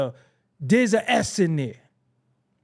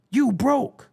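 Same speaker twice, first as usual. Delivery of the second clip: high-pitched teasing jab; acted, squeaky taunt; mocking diss; 9 semitones higher, 4 dB louder than usual. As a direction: jeer at someone for having no money. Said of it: "the number one retort".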